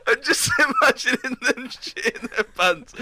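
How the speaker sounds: talking through laughter, high and drawn out; laughing. Real, not acted. Cracking up at imagined kids' accident, laughing. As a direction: struggle to get it out through giggles.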